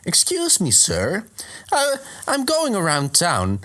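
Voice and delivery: high-pitched polite creature voice; timid caller in a high voice; Venomoth. Acted. Moth Pokemon politely asks warriors for dust, high voice. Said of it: "Venomoth comes in and he's like".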